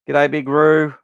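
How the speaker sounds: loud, cheery call-out to a viewer; hearty greeting. Real, not acted. Podcaster loudly greeting a chat regular. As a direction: boom out a welcome to a mate. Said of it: "greeting a chat member".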